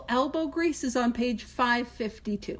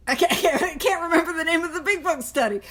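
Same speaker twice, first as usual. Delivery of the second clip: quick words through real laughter; giggling at her own blank memory; laughing it off; 5 semitones higher, faster than usual. Real, not acted. To the group, forgetting the study's name, laughing fast.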